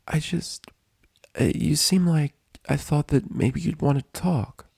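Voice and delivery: a kind, unsure nurse; gentle nurse. A narrator's reading. Nurse voiced higher.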